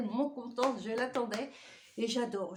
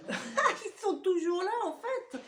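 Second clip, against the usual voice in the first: laughing; 8 semitones higher, 3 dB louder, slower than usual. Real, not acted. Laughing about the interruption.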